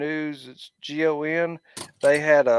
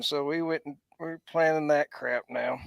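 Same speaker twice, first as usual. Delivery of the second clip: normal talking voice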